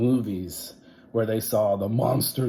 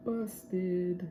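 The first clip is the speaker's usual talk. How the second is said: teasing; 10 semitones higher, 6 dB quieter than usual. Acted. Narrator's playful aside.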